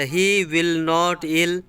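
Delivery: stretched out very slowly; slow example. Shown as a demonstration. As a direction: drag each word for the class. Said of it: "समझे कि नहीं". (बहुत खींचकर धीरे बोलना)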